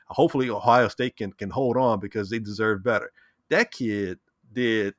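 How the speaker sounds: ordinary delivery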